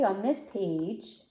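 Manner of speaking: ordinary delivery, nothing marked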